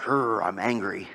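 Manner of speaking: a playful grumpy groan; mock angry grumble. Shown as a demonstration. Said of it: "Not mad like".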